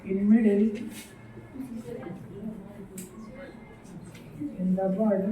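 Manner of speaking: very slow, word by word, pausing for students to write; slow dictation. Acted. Teacher dictating an English definition to the class, slow dictation. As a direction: dictate each word so the class can copy it.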